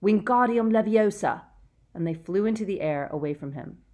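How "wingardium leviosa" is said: loud spell cry; shouting. A narrator's reading. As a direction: shout the incantation. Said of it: "he shouted".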